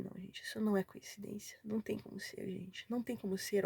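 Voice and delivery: hushed, stunned insistence; retold tearful disbelief. Recalling her reaction on reaching verse five, hushed disbelief. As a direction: softly retell being moved to tears. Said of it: "eu comecei a chorar".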